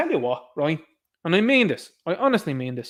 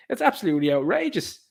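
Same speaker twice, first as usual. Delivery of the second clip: usual speaking voice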